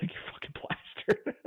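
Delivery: high, soft mock-incredulous squeal; mock disbelief. Imagining Lauren finding them drunk, mock disbelief. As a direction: squeak it softly, drawn out. Acting out Lauren's reaction at home.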